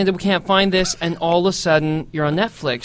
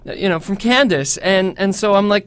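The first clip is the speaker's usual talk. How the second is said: ordinary talk, nothing marked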